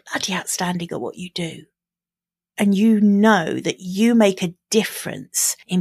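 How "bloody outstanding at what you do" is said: hushed, fast emphatic whisper. Real, not acted. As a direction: drop to a quick conspiratorial whisper. Emphatic aside on being outstanding.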